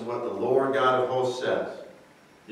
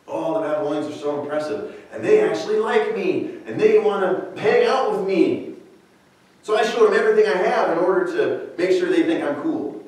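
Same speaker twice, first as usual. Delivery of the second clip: enamored; 5 semitones higher, faster than usual. Acted. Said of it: "They're enamored".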